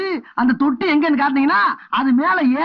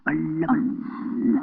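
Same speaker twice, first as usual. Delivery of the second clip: wincing softly; 10 semitones lower, 7 dB quieter, much slower than usual. Acted. Pained leg being eased out gently.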